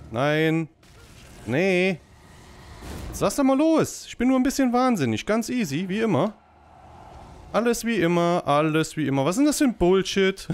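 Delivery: panicking while fleeing; frantic panic. Real, not acted. Fleeing from madness enemy.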